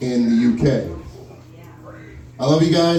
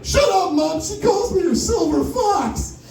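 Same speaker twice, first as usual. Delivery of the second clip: mock outrage; slower than usual. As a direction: snap back at mom, hammed up and high. Retold reply to mom.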